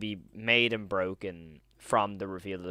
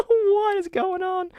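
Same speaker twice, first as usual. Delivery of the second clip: "What is going on" squealed much higher, near crying; overwhelmed squeal; 7 dB louder, slower than usual. Real, not acted. Overwhelmed by new members.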